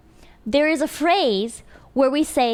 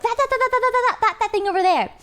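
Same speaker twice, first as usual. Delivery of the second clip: high, rapid repeated "that"; playful high-pitched quote of young friends; mock Chinglish chatter; 7 semitones higher, much faster than usual. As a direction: imitate teen friends babbling "that, that". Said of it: "sometimes we would be like".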